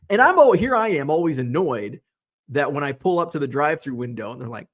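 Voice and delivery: normal talking voice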